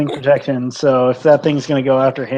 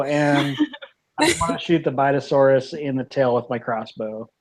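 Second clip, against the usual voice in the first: ordinary delivery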